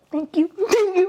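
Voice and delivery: high mock-bashful thanks; awkward thanks. Videographer awkwardly taking compliments, high mock-bashful thanks.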